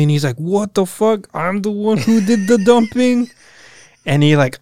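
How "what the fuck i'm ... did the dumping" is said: high, disbelieving outburst; incredulous. Retold or imitated. Hero to ex-girlfriend, shocked he's blamed for the breakup, incredulous. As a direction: play it like he can't believe what he's hearing. Hero voiced in retold scene.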